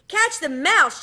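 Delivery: angry shout from Mama. Acted. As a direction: yell at them to catch it. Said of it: "shouted Mama".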